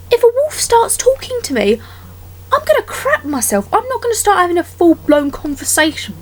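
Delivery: high, panicky exclamation; alarmed outburst. Real, not acted. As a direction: sound freaked out at the thought. Imagining a talking wolf.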